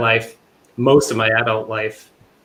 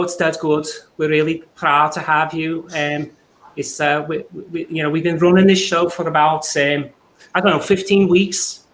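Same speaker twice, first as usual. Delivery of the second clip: chatty Scouse voice, pitched up; Liverpool accent; 5 semitones higher than usual. Pretend. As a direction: do a friendly Liverpudlian lad. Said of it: "really good impersonation of a Liverpudlian".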